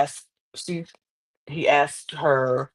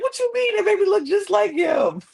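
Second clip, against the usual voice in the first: much higher, loud incredulous shout; Lynch's outraged disbelief; 3 dB louder than usual. Retold or imitated. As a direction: squeal it like you can't believe she asked. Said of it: "Lynch was like".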